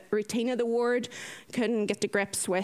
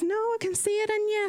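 higher-pitched voicing of a nurse; higher quoted voice, gently contradicting; lead nurse's reply; 10 semitones higher than usual. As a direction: lift the pitch to voice a concerned colleague.

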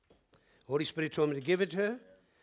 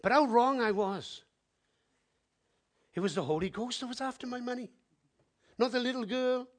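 ordinary delivery

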